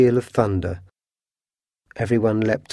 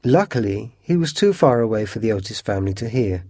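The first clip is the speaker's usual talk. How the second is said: usual speaking voice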